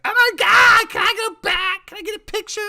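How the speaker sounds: freaking out. Retold or imitated. Husband imitating his wife spotting [name], excited squeal. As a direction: mimic her star-struck excitement, high and loud. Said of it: "my wife starts freaking out".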